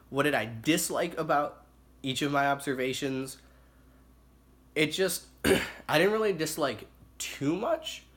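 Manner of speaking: usual speaking voice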